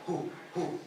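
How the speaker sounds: soft, low-effort "who" on a deep breath; deep-breath natural accent. Shown as a demonstration. Showing listeners how his people talk, deep-breath demo. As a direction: let the breath carry it, softly. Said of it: "we take a deeper breath".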